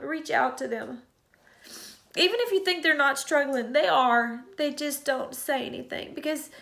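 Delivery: pleading through real tears; urging viewers while still crying. Real, not acted. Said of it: "I did not mean to cry".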